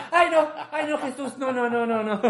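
high, hammed-up horrified protest; mock prudish. Friend mimicking his own false innocence, mock prudish horror. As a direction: squeal it loudly like a fake prude, half laughing.

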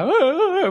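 high mock wailing sobs of a child; imitated crying wail. Imitating daughter crying, not helping.

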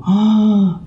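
a high, loud gasp of recognition; an "ah" of sudden understanding; sudden realization. Retold or imitated. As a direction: it all clicks at once. Realizing who the man was.

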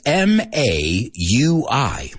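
slow, letter by letter; each letter drawn out and separate; spelling out. Shown as a demonstration. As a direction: spell it out slowly for students. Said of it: "I'm going to spell some words for you".

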